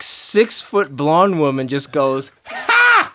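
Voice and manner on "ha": high, abrupt "HA!"; one sharp, high burst of a laugh-like shout; sudden loud "ha". Retold or imitated. Bandleader imitating the woman's outburst in the silent room, sudden loud blurt.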